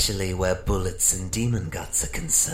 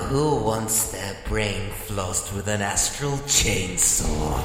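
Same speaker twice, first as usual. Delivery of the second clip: ordinary talk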